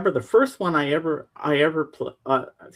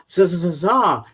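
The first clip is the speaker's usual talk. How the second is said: Sims gibberish; 4 semitones higher, 5 dB louder than usual. Retold or imitated. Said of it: "They go".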